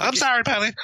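high sing-song apology; high, sweet mock sorry; teasing girlfriend. Girlfriend voiced.

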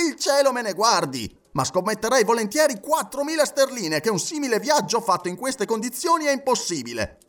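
high-pitched, emphatic refusal; agitated sceptic. Acted. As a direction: insist that it can't be done. (scettico infervorato)

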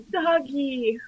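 put-on very high, soft, babyish doggy voice; childlike coo. Imitating Daniel's reaction, a high soft baby coo. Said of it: "Daniel goes".

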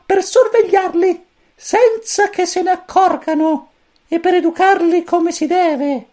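very high, squeaky little chick voice; cosmic chick voice. A narrator's reading. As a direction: chirp it like a tiny alien chick. Pulcino cosmico spiega. (vocina acutissima da pulcino)

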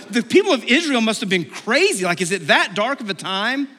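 high, animated disbelief; mock incredulity. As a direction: marvel at how crazy they were. Mimicking our judgement of Israel.

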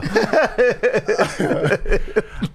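high, real giggling laugh; laughter. Real, not acted. Friends cracking up at the mouth breather joke, real laughter. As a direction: giggle helplessly.

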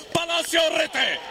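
high, slowed shouted goal; goal call. Real, not acted. Goal called, later disallowed.

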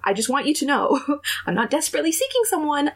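high, rushed over-explaining; spiraling, blubbering. Retold or imitated. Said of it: "I was, I was spiraling".

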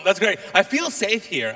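ordinary talk

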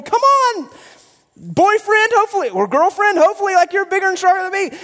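high-pitched hammed-up pleading; mock panic. Scared visitor urging a partner onward, mock panic. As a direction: urge them on in a comic panic.

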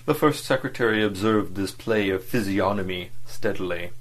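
ordinary delivery, nothing marked

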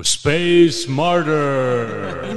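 booming echo effect; digital echo. Shown as a demonstration. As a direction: space martyr with echo. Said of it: "Can you put an echo effect if I go".

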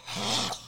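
short, soft high gasp; appalled gasp. Acted. Comic showing how appalled he was, sharp mock gasp. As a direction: gasp as if deeply offended. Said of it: "I was appalled".

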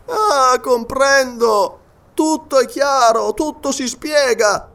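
high, drawn-out exclamation; sudden realisation. Acted. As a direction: cry out as it all becomes clear. Scientist suddenly understands the mistake.

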